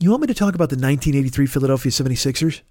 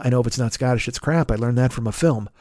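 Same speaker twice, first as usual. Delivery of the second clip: ordinary delivery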